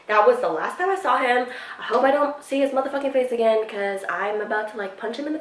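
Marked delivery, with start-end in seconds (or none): none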